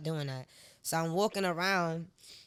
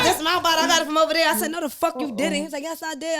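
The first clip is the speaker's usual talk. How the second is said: retold argument; 9 semitones higher, 7 dB louder, faster than usual. Retold argument, both sides voiced.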